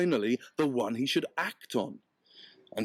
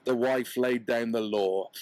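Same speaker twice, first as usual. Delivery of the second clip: ordinary delivery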